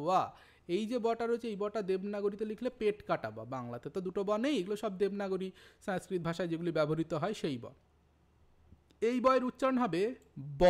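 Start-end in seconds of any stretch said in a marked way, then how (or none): 0.0-0.3 ওয়া with an initial W glide, not a B; w sound. Shown as a demonstration. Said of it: "দেখো উচ্চারণ করলাম". 10.4-10.6 ব said as a firm B, both lips pressed. Shown as a demonstration. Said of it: "ঠোঁট দুটি চেপে উচ্চারণ করতে হয়".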